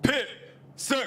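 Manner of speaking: high barked salute call; military salute shout. Real, not acted. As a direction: snap out the salute. Navy sailor salute shout.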